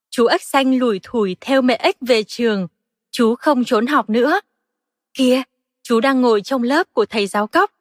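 ordinary delivery

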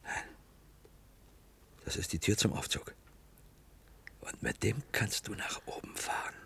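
soft hushed whisper. Acted. Whispering to a partner about the lift. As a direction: hold your breath as you tell it.

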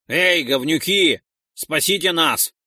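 loud desperate call; rough plea for rescue; shouting for help. Acted. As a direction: yell upward. Original call vs musing repeat.

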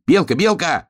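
high-pitched hare call; eager storybook yell. A narrator's reading. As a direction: shout for the squirrel, voice up high. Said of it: "снова закричал он".

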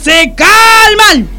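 high, drawn-out order to calm down; commanding shout. Acted. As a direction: shout the quarrel to a halt. Sargento corta la pelea. (grito de orden)

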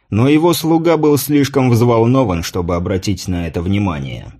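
ordinary delivery

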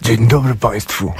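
lightly put-on presenter greeting; a hint of a staged radio voice. Shown as a demonstration. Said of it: "to była pierwsza".

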